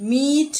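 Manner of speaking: "Meat" with the ee held long; prolonged vowel. Shown as a demonstration. Teacher asking students to repeat, "Meat" drawn out. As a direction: stretch "Meat" out for the class. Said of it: "in a prolonged manner".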